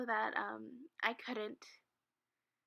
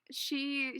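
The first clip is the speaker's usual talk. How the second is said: usual speaking voice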